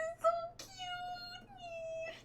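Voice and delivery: very high, slow, soft squeal; gushing squeal. Real, not acted. Viewer gushing over a cute moment, high squeal. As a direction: coo it out in delight. Fans gush at cute scene.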